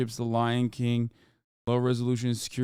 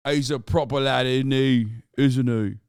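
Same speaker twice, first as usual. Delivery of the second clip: mock British; 5 dB louder than usual. Mock British lad talk.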